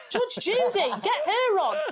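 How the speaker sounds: high, laughing excitement; excited. Real, not acted. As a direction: blurt the idea out, thrilled.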